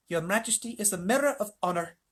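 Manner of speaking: high, lofty little mouse; courtly mouse. A narrator's reading. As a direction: flatter the king grandly in a mouse voice. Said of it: "said the mouse".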